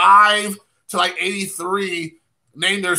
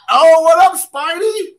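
excited greeting; 8 semitones higher, 6 dB louder than usual. Real, not acted. Greeting a viewer who joins.

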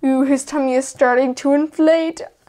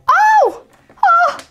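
very high, loud shriek of surprise; startled cry; 6 dB louder than usual. Real, not acted. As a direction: jump at the pop. Balloon pops, game ends.

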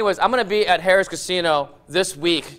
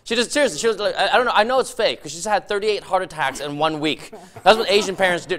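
normal talking voice

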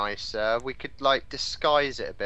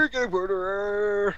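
slow, higher farmer call; 6 semitones higher, slower than usual. Pretend. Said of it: "farmer's voice".

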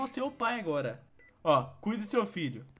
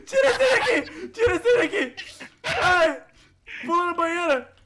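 much higher and louder, laughing through the yell; laughing panicked shout; 8 semitones higher, 10 dB louder, slower than usual. Real, not acted. Dad shouting through laughter for the baby to be removed. Frantic in the game.

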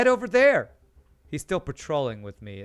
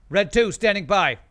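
crisp pilot radio call; radio pilot callout; 6 semitones higher, 8 dB louder than usual. Pretend.

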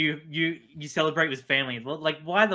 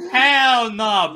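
loud; 6 semitones higher, 7 dB louder than usual. Real, not acted. Mocking protester answer.

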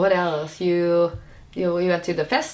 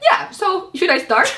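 high, slow and casual; casual guy voice; 12 semitones higher, 4 dB louder, slower than usual. Retold or imitated. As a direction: ask it like a laid-back handyman. Imitating the casual repairman.

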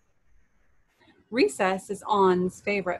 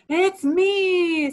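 high, loud, exuberant announcement; big reveal; 11 semitones higher, 8 dB louder than usual. Acted. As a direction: announce yourself with a flourish. Anger reveals himself to On.

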